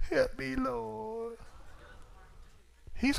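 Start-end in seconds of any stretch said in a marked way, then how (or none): 0.1-1.4 high, long-drawn wail to God; hammed-up keening cry for help, very slow; mock wailing prayer. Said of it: "You know how we do".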